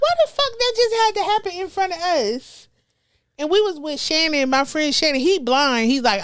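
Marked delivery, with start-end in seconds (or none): 0.0-2.7 high-pitched disbelieving complaint; exasperated. Retold or imitated. Bystander fed up with chaos, high exasperated complaint. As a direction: throw up your hands at bad luck.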